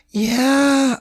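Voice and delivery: put-on very high, slow, tipsy girlfriend voice; drunk girlfriend. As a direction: squeal it like a giddy drunk girlfriend. Admits she is drunk.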